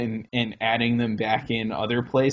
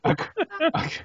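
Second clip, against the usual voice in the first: laughing; 4 dB louder than usual. Real, not acted. Laughing at the car bar question.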